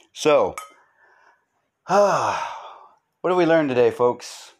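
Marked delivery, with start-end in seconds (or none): 1.9-4.5 heavy theatrical sigh, then a higher voice; big sigh. Pretend. Host wraps up his lesson with a hammed-up sigh. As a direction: sigh it out like a weary teacher. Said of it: "I can sigh with the best of 'em".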